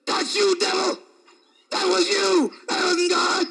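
loud, high rebuke of the devil; jumping up to shout at the devil; rebuking shout. Retold or imitated. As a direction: shout him down. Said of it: "I jumped up and said".

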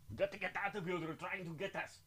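hushed action-hero warning; urgent whisper. Acted. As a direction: whisper it like you're being chased. Urgent escape, dropped volume.

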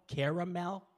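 caramel as CARE-a-MEL, loud and high; three-syllable caramel. Shown as a demonstration. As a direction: give caramel all three beats, "care" first. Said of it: "How many syllables?".

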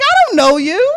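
high loud playful denial; high playful I don't know you; mock denial. Punchline denial.

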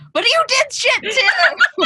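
high, loud, slow accusing yell; mock outburst. Shown as a demonstration. Showing how not to apologize, mock high yell. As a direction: snap back with the but. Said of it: "then go".

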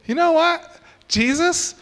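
rising suspense. Acted. Preacher builds suspense.

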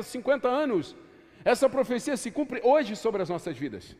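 ordinary talk, nothing marked

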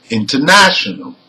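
"international" with main stress on NA, lighter stress on IN; primary stress on NA. Shown as a demonstration. Teacher gives students a word stress example, "international" stressed on NA. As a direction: say "international" punching the third syllable from the end, NA. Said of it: "the primary stress is the third syllable from the end".

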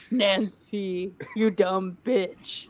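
slow, low mock villain voice; Vecna's deep voice. Co-host plays Vecna scolding Nancy, slow deep voice. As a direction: draw each word out as a deep-voiced villain. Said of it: "like, in a deep voice".

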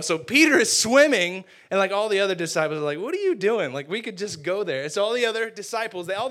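loud, high and fast, riding the thrill; excited storytelling. Real, not acted. Preacher telling the crowd about Peter swimming, excited. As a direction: pile on the excitement. Retelling Peter jumping overboard.

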